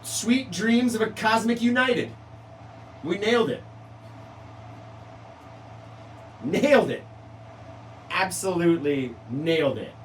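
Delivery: high, loud victory call; triumphant. Real, not acted. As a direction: celebrate getting the name right. Triumphant announcement.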